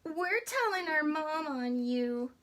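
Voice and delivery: put-on high, loud kid-goat shout. Young goats shouting threats at the troll, high and loud. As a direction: yell it back like cheeky kids. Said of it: "they shouted".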